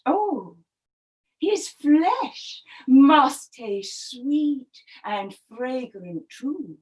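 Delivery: slow, savouring wifely scheming; drawn-out, covetous storybook wife; jealous crocodile wife. Acted. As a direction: linger greedily over each phrase. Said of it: "I think she was jealous".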